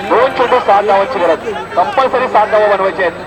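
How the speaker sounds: ordinary delivery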